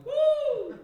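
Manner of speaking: high falsetto woo with a laugh; falsetto whoop. Shown as a demonstration. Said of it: "you just sang in falsetto".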